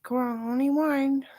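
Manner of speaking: put-on high, slow voice of a little girl; mother doing her little girl's voice; voicing the girl. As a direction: play the little girl pleading slowly. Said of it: "you guys have to play, like".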